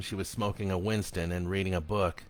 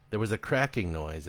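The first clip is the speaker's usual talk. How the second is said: ordinary delivery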